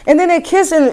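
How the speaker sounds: breathy mock porn sigh; hammed-up panting sigh; mock heavy breathing.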